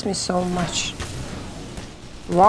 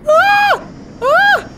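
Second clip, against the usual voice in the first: high piercing startled scream; shrill scream; 4 dB louder than usual. Real, not acted. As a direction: scream in sudden fright. Jump scare in game.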